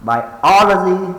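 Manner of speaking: biology with OL high, loud and held; bi-OOL-ogy, punched high on OL; stress on second syllable. Shown as a demonstration. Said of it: "the second one".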